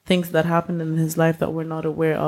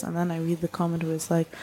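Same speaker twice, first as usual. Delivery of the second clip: ordinary delivery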